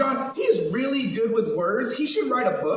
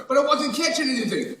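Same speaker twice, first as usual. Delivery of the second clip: normal talking voice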